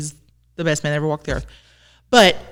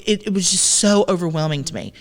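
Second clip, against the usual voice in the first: ordinary talk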